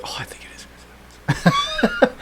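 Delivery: whispered hunch; hushed guess. Retold or imitated. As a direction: breathe it out under your breath. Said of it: "You're like".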